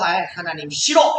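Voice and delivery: sulky, low-pitched refusal; acted resentment. Disappointed believer complaining to God, acted resentment. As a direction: grumble against God like a sulker. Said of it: "하나님 앞에 원망하고 불평한다".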